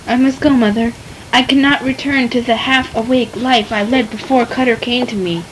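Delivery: low, quiet resolve; soft and sincere. Acted. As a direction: tell her gently and low. Lita softly explains to mother.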